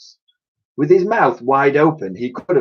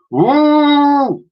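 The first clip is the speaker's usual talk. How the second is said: high long loud mammoth call; mammoth trumpeting; 7 dB louder than usual. Acted. Mammoth call while charging.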